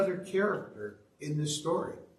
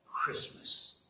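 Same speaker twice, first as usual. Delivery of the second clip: low, hushed Grinch whisper; growled warning; 4 semitones lower, 5 dB quieter than usual. A narrator's reading. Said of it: "he growled with his Grinch fingers".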